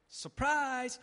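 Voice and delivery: sudden loud high Surprise!; spring it on them like a jump scare; surprise shout. Pretend. Preacher acting the Lord's sudden return with a loud Surprise!. Lord returns mid-sin, mock scenario.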